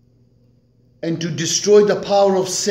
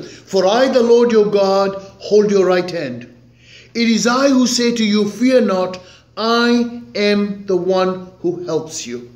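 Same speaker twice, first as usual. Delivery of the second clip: raised, louder scripture reading; preaching scripture; 5 semitones higher, 4 dB louder than usual. Acted. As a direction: proclaim the verses to the congregation. Reads scripture at full force.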